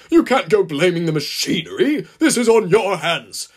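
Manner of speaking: loud, stern station master's scolding; forceful, indignant boss character; angry snapping. A narrator's reading. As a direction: lay down the law to a blundering driver. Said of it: "snapped the man in charge".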